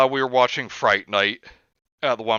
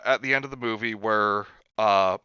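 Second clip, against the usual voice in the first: normal talking voice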